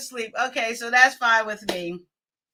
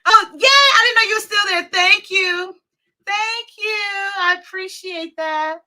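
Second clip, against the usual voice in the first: excited thanks; 7 semitones higher, 5 dB louder than usual. Real, not acted. Thanking surprise applause.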